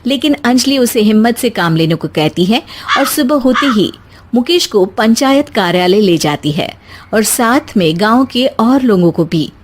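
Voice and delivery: usual speaking voice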